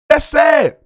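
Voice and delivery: flat, let-down "That's sad"; a touch of mock pity; dismissive sigh. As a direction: say it like it's a shame. Mock pity at Kwame Brown.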